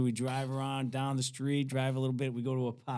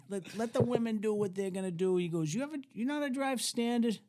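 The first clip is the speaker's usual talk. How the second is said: lightly voiced higher-pitched older buddy; imitated old hippie; 9 semitones higher than usual. Old hippie leaving the women behind, lightly imitated coaxing. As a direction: lift the pitch for a coaxing character.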